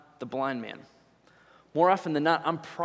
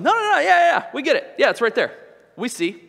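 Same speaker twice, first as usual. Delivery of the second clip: much higher, louder, rushed no no no yeah yeah; loud dismissive crowd; 9 semitones higher, 9 dB louder than usual. Pretend. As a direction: drown him out with eager fake agreement. Acted crowd brushing him off.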